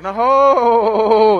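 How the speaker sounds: booming mock-laughing no, no, no; a loud, drawn-out no-ho-ho at the devil; loud refusal. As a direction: shout it down like a preacher swatting a lie away.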